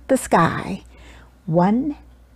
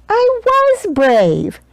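high, slow proud statement; proud realization; 11 semitones higher, much slower than usual. A narrator's reading. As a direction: savor the discovery slowly. Child's realization.

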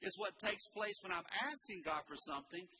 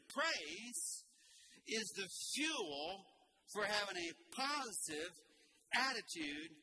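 usual speaking voice

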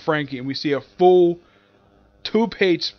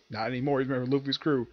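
ordinary delivery

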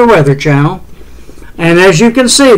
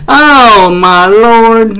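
higher, drawn-out exclamation of dismay; exasperated dismay; 5 semitones higher, 3 dB louder, much slower than usual. Real, not acted. Forecaster whose map just quit on air, exasperated. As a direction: groan it out as the setup fails. Weather map quits on him.

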